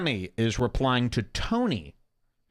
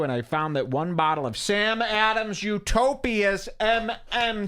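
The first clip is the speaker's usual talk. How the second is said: hammed-up tweet reading; 9 semitones higher than usual. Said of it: "Tony says".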